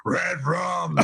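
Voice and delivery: spooky horror movie growl; scary voice. Retold or imitated. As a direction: go for creepy Halloween menace. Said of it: "my scary voice".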